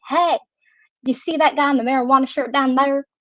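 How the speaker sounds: imitated backwoods Arkansas accent; mock a kid's country Arkansas accent; worst accent ever. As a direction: run the words together in a backwoods Arkansas accent. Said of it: "that is how he talked to me".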